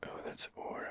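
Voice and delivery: ordinary talk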